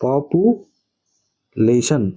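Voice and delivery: पॉपुलेशन very slowly, with a long gap before लेशन; syllable-group demo. Shown as a demonstration. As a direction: say पॉपुलेशन in drawn-out chunks, पॉपु then लेशन. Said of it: "जब हम pronunciation करते हैं तो क्या पढ़ते हैं".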